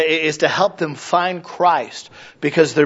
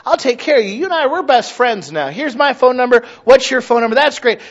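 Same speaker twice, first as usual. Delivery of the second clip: higher, eager, gushing buddy voice; mock fake-friendly churchgoer; 6 semitones higher than usual. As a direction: ham up the overly chummy do-gooder.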